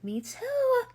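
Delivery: squeaky me too. Retold or imitated. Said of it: "they're like".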